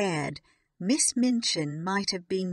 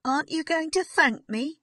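high, fast, indignant demand; loud, quick, high-pitched challenge; calling out; 10 semitones higher, 5 dB louder, faster than usual. A narrator's reading. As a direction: call after the child, voice raised. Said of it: "Miss Minchin called out".

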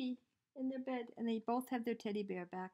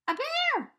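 much louder, far higher scream; 11 dB louder than usual. A narrator's reading. Eddie sees the bear, loud frightened scream. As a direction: shriek in fright. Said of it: "screamed Eddie".

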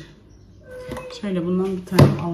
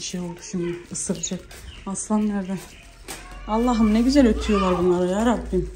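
normal talking voice

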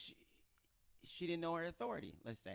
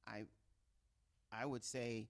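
ordinary talk, nothing marked

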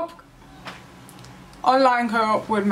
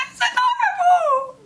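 very high, squealed gush; squeal de fofura. Real, not acted. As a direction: squeal it in delight at something adorable. Squealing at a cute game reveal.

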